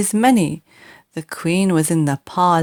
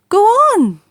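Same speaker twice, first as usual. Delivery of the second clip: high, loud call; a bright shouted urging; 12 semitones higher, 6 dB louder than usual. A narrator's reading. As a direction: shout it out eagerly. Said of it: "she shouted".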